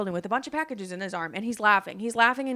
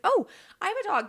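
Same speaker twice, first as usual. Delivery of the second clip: high, eager retold "oh!"; excited exclamation; 11 semitones higher, 4 dB louder than usual. Telling a new friend she has a dog too, excited. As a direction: jump in with sudden delight.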